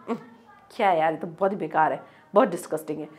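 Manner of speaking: low, soft grossed-out groan; disgusted. Shown as a demonstration. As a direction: recoil from something vile. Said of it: "तब हम ऐसे ही use करते हैं".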